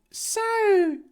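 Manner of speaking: high, loud drawn-out opener; nervous Harry. Acted. Harry beginning to speak to Ronald, nervously. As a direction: start in a piping character voice. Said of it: "Harry began nervously".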